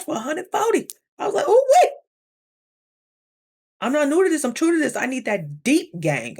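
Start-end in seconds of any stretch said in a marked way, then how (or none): 1.5-1.9 much higher and louder, thrilled; excited squeal. Retold or imitated. Spotting a cheap two-hour massage, a loud high squeal. 3.8-5.0 louder, higher, full of swagger; sassy boast. Real, not acted. Bragging about massage taste, loud sassy swagger.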